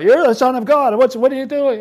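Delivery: high, loud startled cry; mock alarm. Pastor voicing demons recognizing Jesus, high and loud alarm. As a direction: blurt it out in a panic. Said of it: "they acknowledge him immediately".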